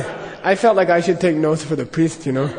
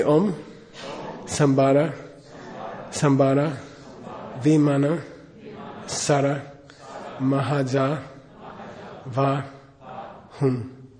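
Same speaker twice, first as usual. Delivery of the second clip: slow, even chanting of each syllable; mantra recitation; 5 dB quieter, slower than usual. Acted. Teacher leading students in mantra recitation, measured chant. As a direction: lead the group through the mantra.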